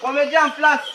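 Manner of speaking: calling out on set, loud; director shouting. Acted. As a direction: shout the cue across the set. Director calls start on set.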